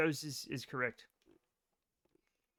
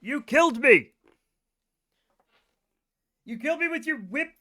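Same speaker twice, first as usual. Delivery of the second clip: loud, hammed-up cry of defeat; mock outrage; 6 semitones higher, 6 dB louder than usual. Laughing at a friend's joke, a mock loud outcry. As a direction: shout it like the joke was fatal.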